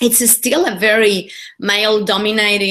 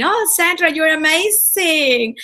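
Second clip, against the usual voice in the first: a much higher, slow mock Spanish accent on "amazing"; mocking her Spanish accent; 7 semitones higher, slower than usual. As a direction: tease her Spanish accent, high and drawn out. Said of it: "making fun of my accent".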